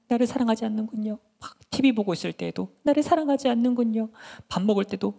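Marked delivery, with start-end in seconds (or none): 0.1-1.2 high, whiny reproach; acted plaintive nagging; nagging refrain. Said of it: "삼손을 맨날 따라다니면서". 2.8-4.1 high, whiny reproach; acted plaintive nagging; nagging refrain. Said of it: "삼손을 맨날 따라다니면서".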